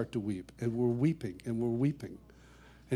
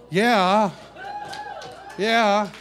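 put-on high, loud eager old man; dad's voice; 8 semitones higher, 9 dB louder than usual. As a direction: play dad, eager. Voicing dad's reply.

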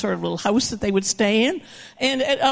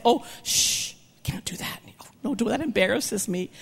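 hushed shushing; 5 dB quieter than usual. Retold or imitated. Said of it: "we'd say".

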